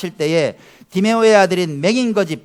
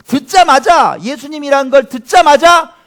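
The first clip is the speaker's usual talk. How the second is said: loud, high, building preacher voice; raised, forceful delivery; emphatic preaching; 8 semitones higher, 9 dB louder than usual. Real, not acted. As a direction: hammer it out to the congregation.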